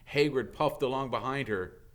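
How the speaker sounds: usual speaking voice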